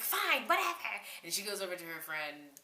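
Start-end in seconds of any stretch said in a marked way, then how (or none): 0.0-0.9 high, petulant mock brush-off; squeaky dismissive retort; huffy mimicry. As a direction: imitate a roommate storming off in a huff. Mimics roommate giving up in huff.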